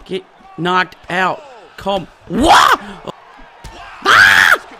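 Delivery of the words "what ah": shocked yelling. Real, not acted. Gamer nearly knocked out, shocked yells. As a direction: yell in shock at each blow. Hit while near knockout.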